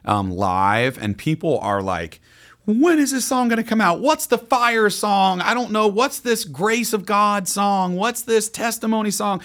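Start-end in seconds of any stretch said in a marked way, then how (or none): 2.6-9.4 eager fans. Retold or imitated. Voicing eager fans asking.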